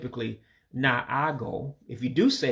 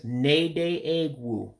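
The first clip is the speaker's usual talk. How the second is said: "nede egwu" very slow, each syllable clear; slow Igbo phrase; much slower than usual. Shown as a demonstration. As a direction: stretch "nede egwu" out for learners. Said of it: "describe what type of person it is".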